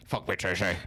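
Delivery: low, odd growled voice; low mock villain growl; No-Face voice. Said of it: "Do, do the voice".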